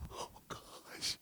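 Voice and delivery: whispered gasp of amazement; hushed astonishment. Acted. Man in parable finds treasure, hushed astonishment. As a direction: breathe it out, barely voiced.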